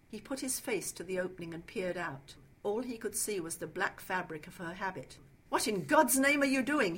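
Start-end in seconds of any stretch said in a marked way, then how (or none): 5.5-7.0 loud, high, outraged demand; angry demand. A narrator's reading. Trapped man to Mother Superior, outraged demand. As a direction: demand it at raised pitch and volume. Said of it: "he demanded".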